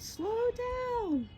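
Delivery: high, drawn-out mock yell at no great volume. Joyce runs out and yells after the van, high mock yell. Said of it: "and she yells out".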